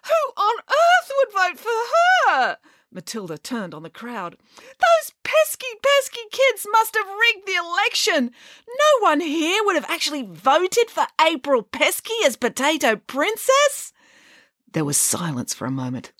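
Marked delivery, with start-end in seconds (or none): 0.0-2.6 very high, drawn-out outraged wail; shrieky, slow lament. A narrator's reading. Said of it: "wailed Matilda". 4.6-13.9 high-pitched, bitter accusation; outraged tirade. A narrator's reading. Matilda rages at the crowd.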